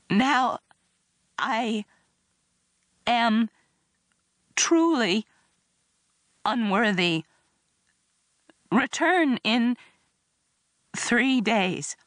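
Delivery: high, halting sobbed delivery; one word at a time through acted tears; barely able to speak. A narrator's reading. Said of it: "I could barely speak".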